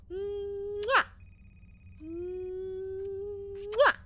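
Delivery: two high smacking air kisses; double air kiss. Acted. Blowing kisses to pet.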